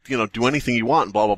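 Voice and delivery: ordinary delivery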